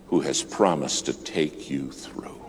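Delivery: slow, soft whisper; whispered promise. Real, not acted. Preacher whispering God's promise to the congregation. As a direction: breathe it to them as a secret comfort. Quiet close of sermon.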